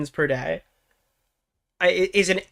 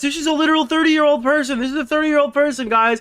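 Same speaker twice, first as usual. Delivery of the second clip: mocking commenters; 6 semitones higher than usual. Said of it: "all the comments".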